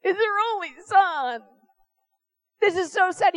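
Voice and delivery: mock grief over the widow's only son; mock mourning. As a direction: play a mourner at the funeral, half in jest. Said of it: "we're crying at the funeral".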